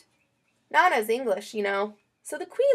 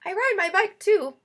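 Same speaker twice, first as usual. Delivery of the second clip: ordinary delivery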